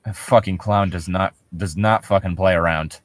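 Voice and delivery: usual speaking voice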